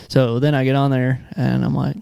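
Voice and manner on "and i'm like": ordinary delivery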